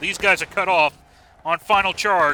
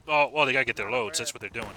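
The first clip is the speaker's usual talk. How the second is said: ordinary talk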